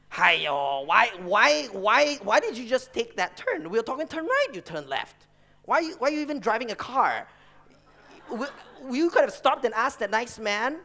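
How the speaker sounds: mock a nagging mother from the back seat; nagging mom. Son imitating his mom scolding dad's driving, nagging. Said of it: "She'd be like".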